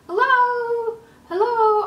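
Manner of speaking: high, loud calling out; yelling hello. Retold or imitated. Locked out on the balcony, calling for help loudly. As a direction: call out for anyone nearby. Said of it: "I started yelling".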